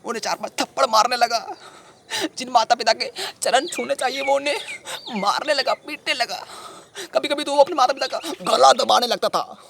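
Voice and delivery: high-pitched, appalled telling; distressed outrage. Acted. Telling sahib he beat his parents, distressed outrage. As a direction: recount it high, in horrified protest. Acted horror at beating of parents.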